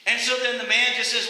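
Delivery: normal talking voice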